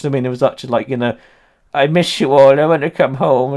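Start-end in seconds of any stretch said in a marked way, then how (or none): 1.7-3.5 hammed-up pathetic emperor begging; mock whiny Napoleon. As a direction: whimper it like a homesick emperor. Mocking Napoleon's plea again.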